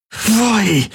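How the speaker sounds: high, strained cry between contractions; pressed out in pain. Acted. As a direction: squeeze the name out through labor pains. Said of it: "presste sie zwischen den Krämpfen hervor".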